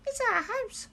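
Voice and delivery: very high squeak; squeaky mouse voice. A narrator's reading. Mother mouse squeaks to George about her burned house. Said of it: "squeaked the mother mouse".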